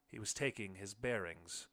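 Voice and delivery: ordinary delivery, nothing marked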